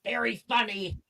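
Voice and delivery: muffled from under leaves. Acted. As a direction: voice muffled by foliage. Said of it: "Morgan's muffled voice".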